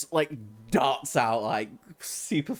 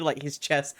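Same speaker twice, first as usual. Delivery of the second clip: ordinary delivery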